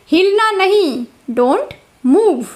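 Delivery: normal talking voice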